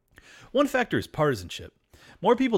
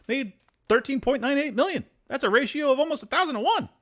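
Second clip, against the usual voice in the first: much higher, pitched-up disbelief; incredulous; 10 semitones higher than usual. Real, not acted. Host reacting in disbelief to the CEO pay ratio, voice high.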